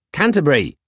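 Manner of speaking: Canterbury shouted high with a falling tune; calling out. Acted. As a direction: bark Canterbury sharply. Calling the robot again, impatient.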